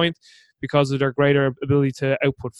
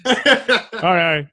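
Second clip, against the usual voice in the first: ordinary delivery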